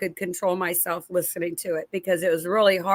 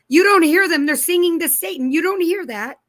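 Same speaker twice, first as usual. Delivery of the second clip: much higher and louder, urgently insisting; reliving the alarm, pleading loud and high; retold alarm; 9 semitones higher, 6 dB louder than usual. To her husband in the car, loud high alarmed insisting.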